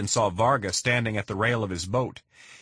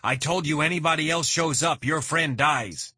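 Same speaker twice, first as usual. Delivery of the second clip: yelled threat; 7 semitones higher than usual. A narrator's reading. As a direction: bark the threat at him with the gun raised. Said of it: "Blondie yelled".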